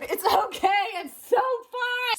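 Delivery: high, stretched-out excited squeal; giddy squeal. Real, not acted. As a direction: let it climb high and drag out "fun". Giddy self-justification, escalating.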